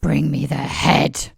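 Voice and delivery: slow, loud, menacing whisper; cold furious whisper. Acted. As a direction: threaten in a deadly hush. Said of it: "absolutely furious".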